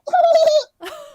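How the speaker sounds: high little signature giggle. Shown as a demonstration. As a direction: giggle it so friends know it's you. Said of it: "I would just do, like, a little giggle".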